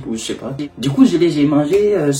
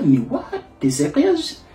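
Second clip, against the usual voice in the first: mock disbelief; 5 semitones higher than usual. Stunned at the woman's offer.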